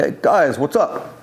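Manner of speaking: a lightly imitated thick Greek accent, friendly; hint of a Greek accent, casual hello. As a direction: greet them as an older Greek building owner, accent a touch put on. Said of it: "he had a thick accent".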